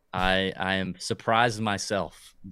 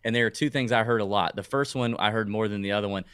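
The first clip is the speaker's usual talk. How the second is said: ordinary delivery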